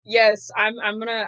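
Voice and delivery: ordinary talk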